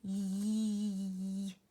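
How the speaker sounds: Yee held out long, a tickle at the back of the throat; stretched Y. Shown as a demonstration. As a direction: stretch the Y sound long and silly. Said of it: "Here's what Y sounds like when you stretch it out".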